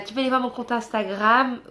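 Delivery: ordinary talk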